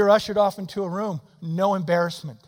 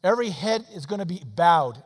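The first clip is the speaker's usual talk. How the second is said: usual speaking voice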